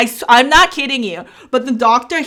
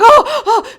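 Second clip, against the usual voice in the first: high, loud pained oh oh; pained groans; 11 semitones higher, 6 dB louder than usual. Retold or imitated. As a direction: groan through the pain. Labor pain groans.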